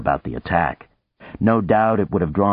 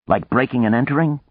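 sarcastic retort; 8 semitones higher, 3 dB louder, faster than usual. Acted. Boone mocking intruders.

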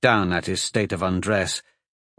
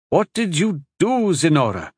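high, sharp accusation; accusing; 7 semitones higher, 3 dB louder than usual. Acted. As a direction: demand an answer, upset. Couple argues outside door.

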